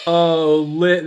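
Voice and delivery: usual speaking voice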